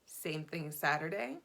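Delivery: normal talking voice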